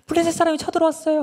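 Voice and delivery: high, loud, rapid warning; acted panicked shout; alarmed cry. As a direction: shout a hurried alarm to wake someone. Delilah raises alarm once more.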